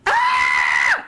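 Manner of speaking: short high shriek of a startled woman; imitated scream. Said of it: "this woman goes ... and screams".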